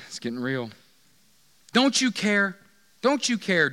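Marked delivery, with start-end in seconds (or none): 1.7-3.7 loud, higher-pitched mock complaint; acted indignant plea. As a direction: demand it like a put-upon sister. Acts Martha's whining demand to Jesus.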